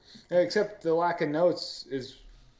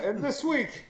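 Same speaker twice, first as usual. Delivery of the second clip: laughing; 7 semitones higher, 4 dB quieter, slower than usual. Real, not acted.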